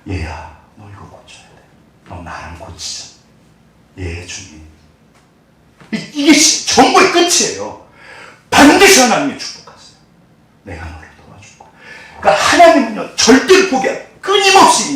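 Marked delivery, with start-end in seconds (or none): 0.1-4.6 hushed whisper. Acted. God and believer, hushed. 10.6-11.7 very soft, low reassurance; God quietly promising to help his child; tender promise. Acted.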